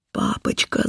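airy, horrified gasp of the word; cry of horror. A narrator's reading. Terrified girl calls to her father, horrified cry. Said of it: "закричала я в полном ужасе".

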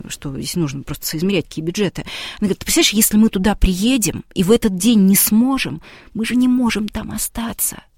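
usual speaking voice

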